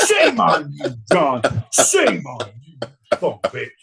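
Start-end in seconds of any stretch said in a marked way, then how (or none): none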